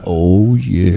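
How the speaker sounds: low, deep put-on voice. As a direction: say it in a deep mock voice. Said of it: "super deep like this".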